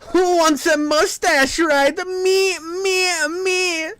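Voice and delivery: very high, squeaky eager voice; mock eager volunteer. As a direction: pipe up high like an over-keen kid. Mock child volunteering for a mustache.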